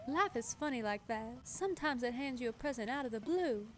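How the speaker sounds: put-on high, lilting Princess and the Frog accent; Elise, Southern accent. Villager muses on her luck, put-on Southern accent. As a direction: muse like a Southern belle. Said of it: "I love her accent".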